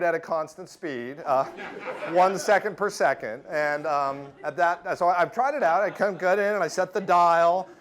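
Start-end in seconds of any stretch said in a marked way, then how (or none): none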